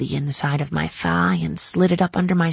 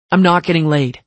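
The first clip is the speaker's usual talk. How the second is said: snapping back; 7 dB louder than usual. A narrator's reading. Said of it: "Nash shot back".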